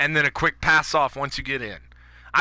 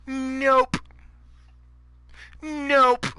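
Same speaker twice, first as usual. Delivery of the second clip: mocking nope; 7 semitones higher than usual. Real, not acted. Mock deflation.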